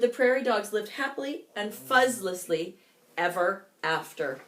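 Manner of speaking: ordinary delivery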